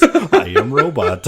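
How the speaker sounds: slow, loud robot impression through laughs; imitating the robot, laughing. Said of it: "it was the voice".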